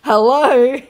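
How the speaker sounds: high, loud call; hammed-up hello; mock scammer voice. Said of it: "he says".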